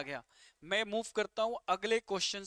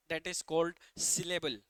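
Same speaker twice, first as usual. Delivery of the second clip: slow summary; 3 semitones lower, much slower than usual. Shown as a demonstration. Teacher sums up slowly.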